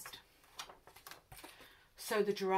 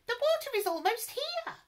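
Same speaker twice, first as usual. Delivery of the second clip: very high squeaky voice; squeaky giraffe; 4 dB louder than usual. A narrator's reading. Giraffe squeaking a warning to the animals. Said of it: "he squeaked in his high voice".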